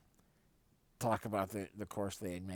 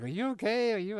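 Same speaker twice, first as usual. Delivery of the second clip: higher, louder mock concern; worried mom; 12 semitones higher, 8 dB louder than usual. Mom checking on her son after the bump, high worried voice. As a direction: ask anxiously as the mom. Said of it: "she was like".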